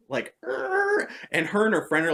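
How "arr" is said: high, drawn-out scratching sound; high-pitched mock scratch noise; imitated scratching noise. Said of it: "I started scratching it".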